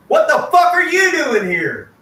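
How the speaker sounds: high, loud furious demand; retold angry shout; angry boss yell. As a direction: snap it at him like she's enraged. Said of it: "and she goes".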